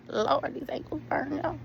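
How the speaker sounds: ordinary talk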